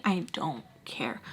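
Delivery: whispered under the breath; hushed, dismissive whisper. Real, not acted.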